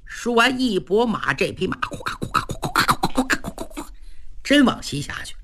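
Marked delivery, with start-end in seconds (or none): 1.3-3.9 rapid, breathy clatter of "夸" syllables; fast onomatopoeic gallop; galloping hoofbeats. Acted. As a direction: rattle off hooves like a horse racing away. Narrator imitates galloping hoofbeats.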